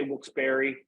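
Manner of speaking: Wilkes-Barre with a final "ee", like berry; "berry" pronunciation. Shown as a demonstration. Local listing how people say it, Wilkes-Barre as "berry". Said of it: "people do say".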